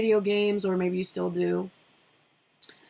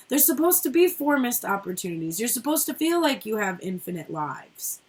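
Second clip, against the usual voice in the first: ordinary delivery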